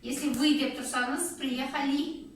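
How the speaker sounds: ordinary talk, nothing marked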